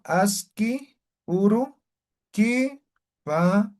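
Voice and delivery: "aski, uru, kipanay" spaced out, "kipanay" drawn out slowly; slow dictation. Shown as a demonstration. Teacher dictating the greeting reply to students, slowly. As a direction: dictate each word slowly for the class to copy. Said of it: "Y la contestación".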